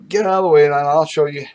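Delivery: fed-up farmer grabbing the pipe; impatient. Retold or imitated. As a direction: brush him off and take over. Aggie impatiently taking over.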